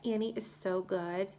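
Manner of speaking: usual speaking voice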